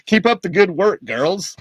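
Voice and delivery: hammed-up cheery farewell; mock cheerful send-off. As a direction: play the naive hero waving them off. Mocking the heroes' farewell.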